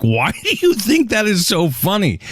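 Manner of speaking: high mocking voice; a teasing high-pitched quip; mocking question. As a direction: ask it up high like teasing her. Mocking sister's self-laughter.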